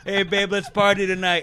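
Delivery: loud, high-pitched mock come-on; hammed-up party shout. Cartoon tiger messaging someone's wife, hammed-up and loud. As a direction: belt it out as a cartoon seducer. Acts out flirty message from Hobbs.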